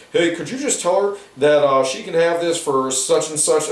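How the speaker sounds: hint of the young friend asking a favor; lightly voiced friend. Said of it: "He's like".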